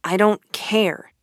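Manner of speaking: slow, stubborn refusal; doggedly. Acted. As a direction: dig in your heels. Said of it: "said Rat doggedly".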